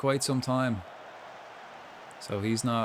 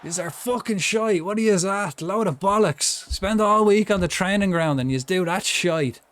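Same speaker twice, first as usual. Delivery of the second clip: loud, high-pitched team telling-off; berating; 7 semitones higher, 6 dB louder than usual. Pretend. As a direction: tear into the lads at high volume. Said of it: "Let me berate yous".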